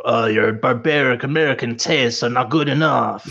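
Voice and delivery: mock snooty European accent, half-shouted; generic European accent. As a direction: sneer it like a haughty European critic. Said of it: "I don't even know what accent that was".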